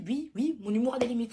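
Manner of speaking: ordinary delivery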